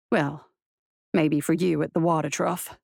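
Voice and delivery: low, dry marshal voice; wry marshal. A narrator's reading. Marshal confides in his horse after waking, wry.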